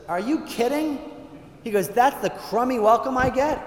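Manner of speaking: outraged at the crummy welcome; indignant. Acted. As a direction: Augustinian insulted by a shabby welcome, indignant. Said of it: "he's getting really ticked off".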